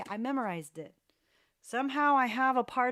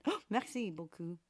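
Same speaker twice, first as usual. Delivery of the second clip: ordinary talk, nothing marked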